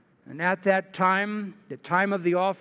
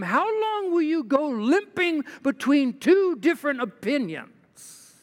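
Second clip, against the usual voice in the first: higher, emphatic preacher voicing Elijah; prophet's challenge; 8 semitones higher than usual. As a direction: read it as a prophet challenging the crowd. Elijah challenges the people, read aloud.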